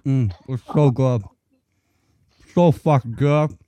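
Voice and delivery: talking through a mouthful of burger; mouth full. Real, not acted. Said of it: "talk with my mouth full".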